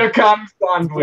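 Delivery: put-on Jamaican; Jamaican accent. Shown as a demonstration. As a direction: deliver it in a jokey Jamaican accent. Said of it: "Jamaican accent".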